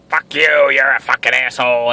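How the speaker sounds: put-on drunk ex. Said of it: "drunk voicemails".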